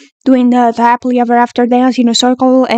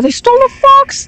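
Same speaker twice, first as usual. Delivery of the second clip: shrieks it out, much higher; shocked shriek; 12 semitones higher than usual. Real, not acted. Fan watching a music video shrieks in shock. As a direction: squeal of disbelief at the screen.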